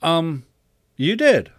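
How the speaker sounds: hesitant, then high exclamation; puzzled kid answering up high; somewhat confused. A narrator's reading. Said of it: "somewhat confused".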